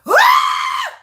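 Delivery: high squealing laugh; shrieking laugh at her own joke. Real, not acted. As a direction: burst into a loud squeal of laughter.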